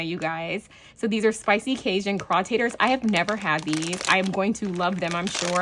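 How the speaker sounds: ordinary delivery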